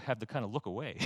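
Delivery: ordinary talk, nothing marked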